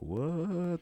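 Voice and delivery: high, drawn-out incredulous what; mock disbelief. Retelling Tony's reply about the palladium, mock disbelief. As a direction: stretch it upward in mock shock.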